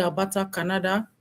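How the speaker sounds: ordinary delivery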